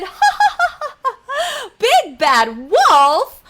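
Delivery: high laughing cackle into a scoff; mocking laughter. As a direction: laugh off the idea of a wolf. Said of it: "the three little pigs laughed".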